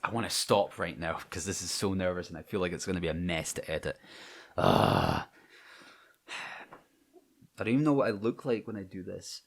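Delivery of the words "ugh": a low, loud groan of frustration; frustrated groan. Real, not acted. Nervous about filming himself, a frustrated groan. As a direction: let out a fed-up ugh. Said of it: "It's so frustrating".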